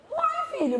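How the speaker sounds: high, surprised exclamation; surprised uai. Retold or imitated. Mother answers her son, high and surprised. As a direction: react with amused surprise.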